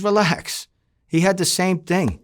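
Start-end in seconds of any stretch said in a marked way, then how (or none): 0.0-0.7 sharp relax. Retold or imitated. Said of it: "He says".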